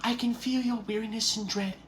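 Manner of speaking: high, soft fairy voice; pixie. Acted. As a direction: float it out like a tiny helpful pixie. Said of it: "and it begins to speak".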